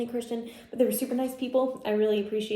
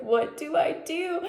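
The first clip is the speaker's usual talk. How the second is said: pitched higher, slow, panicky question; mock panic; 7 semitones higher, slower than usual. Seeing the positive test, asking herself in panic. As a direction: replay the moment of panic, drawn out. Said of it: "I was freaking out".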